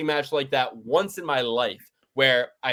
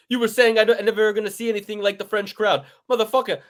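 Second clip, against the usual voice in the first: higher-pitched, worked-up retelling of a fighter; Moicano impression; 6 semitones higher, 3 dB louder than usual. Podcaster retelling a fighter's retort about the French crowd, high-pitched imitation. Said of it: "I love what Moicano said".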